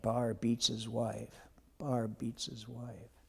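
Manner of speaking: soft, slow whispered jeer; whispered taunt. Retold or imitated. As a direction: hiss the taunt under your breath, slowly. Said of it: "And he kept saying it".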